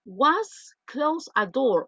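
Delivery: very slow reading of a student's sentence; slow read-aloud. Shown as a demonstration. Teacher slowly reading out Daisy's wrong sentence. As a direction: drag it out to show the error. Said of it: "Dice Daisy".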